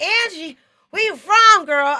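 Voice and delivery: high, loud, excited American greeting; mock American DJ. Americans greeting the Brit guest, high and loud. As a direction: call out like an American radio host. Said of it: "They were like".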